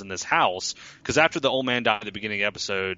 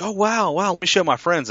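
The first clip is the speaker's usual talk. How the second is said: much higher, louder mock gush; excited woman; 10 semitones higher, 4 dB louder than usual. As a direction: gush like an impressed woman at the bar. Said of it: "and she's like".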